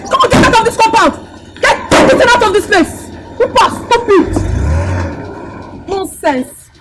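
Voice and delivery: very high, loud tirade; furious shouting. Acted. Woman chasing visitor from her compound, furious shouting. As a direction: drive the intruder out with your voice.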